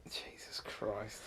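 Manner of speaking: slow, breathless whisper of disbelief; a slow, stunned whisper. Real, not acted. Hearing the Dean Gaffney headline.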